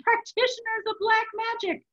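high, strained protest; earnest objection. A narrator's reading. Abbot defends himself to the monk, earnest objection. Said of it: "Hubert objected earnestly".

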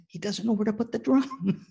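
high-pitched comic disbelief; incredulous. Retold or imitated. Speaker to band director about the astronaut, incredulous. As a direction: squeak it out in mock bafflement.

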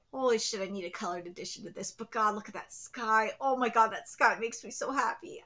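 low, hushed, overwhelmed delight; hushed awe. Real, not acted. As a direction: drop low and soft in awe. Overwhelmed by the art.

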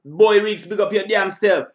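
loud, high, pumped-up shout-outs; big him up with full energy; hyped shout-outs. Real, not acted. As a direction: hype man calling out names. Hyped shout-outs after the track.

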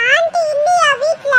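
put-on high, squeaky kid voice; high childish questioning; concerned child friend. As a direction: ask slowly in a small child's high voice. Child Pappu asks about aunty.

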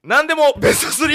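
high, fast yelled segment title; hollered title call. Pretend. Host announces the radio segment, hollering. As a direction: belt out the corner name. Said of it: "ちょっとがなりを忘れてました".

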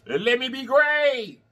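high, slow victorious sing-song; triumphant cheer. Real, not acted. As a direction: celebrate getting the meat out. Triumph over the meat.

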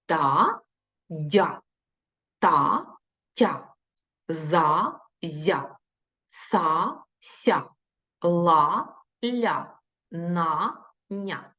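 put-on slow, clear syllable-by-syllable drill for children; reading syllables aloud. Shown as a demonstration. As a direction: model each syllable so the class can repeat. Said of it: "Читаємо разом зі мною". (чітке читання складів разом з дітьми)

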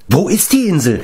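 high, urgent question; insistent pressing. A narrator's reading. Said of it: "drängte Taran". (drängend)

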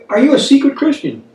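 normal talking voice

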